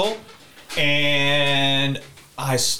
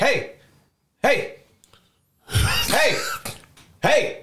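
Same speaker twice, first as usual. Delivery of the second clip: calling out; 13 semitones higher, much slower than usual. Retold or imitated. Calling out to passed-out man.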